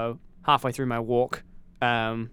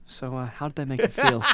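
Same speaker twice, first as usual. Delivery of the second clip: mock therapist. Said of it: "For dramatic purposes".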